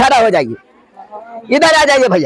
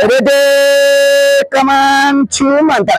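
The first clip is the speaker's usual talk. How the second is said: very slow, stretched spell call; drawn-out magic word; much slower than usual. Acted.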